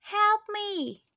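high-pitched plea, called out; cry for help. A narrator's reading. Boy in the sea calling for help, crying out. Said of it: "he cried".

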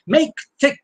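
"Make thick" pitched high, both words stressed; two consecutive stresses. Shown as a demonstration. Lecturer showing two stressed syllables, "Make thick" both hit high. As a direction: punch "Make" and "thick" as equal beats. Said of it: "two consecutive stressed syllables".

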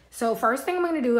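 ordinary talk, nothing marked

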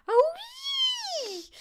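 drawn-out piercing yelp; yell. A narrator's reading. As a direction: yelp rising into a long squeal. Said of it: "Grandma yelled".

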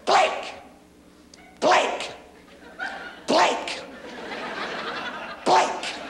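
high, sharp repeated calling of a name; apoplectic wife. Acted. Wife calling husband from the kitchen, apoplectic. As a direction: summon him in a hammed-up fit. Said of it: "some kind of damn conniption fit".

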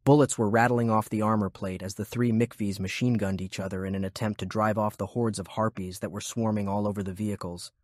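ordinary delivery, nothing marked

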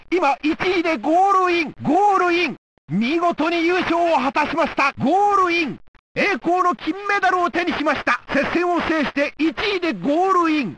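high, ringing victory call; excited goal call. Acted. Announcer hailing the winner crossing the line, excited.

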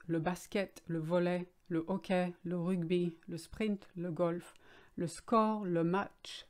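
English sports words said with a French accent; French accent on each loanword. Shown as a demonstration. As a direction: read the list like a French speaker, French accent. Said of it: "just remembering French accent".

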